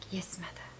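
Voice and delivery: very soft, hushed and adoring; loving reverence. Retold or imitated. Answering the spirit Mother Aya, soft loving reverence. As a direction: breathe it out with devotion. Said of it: "with so much love".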